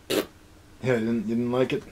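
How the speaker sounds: ordinary talk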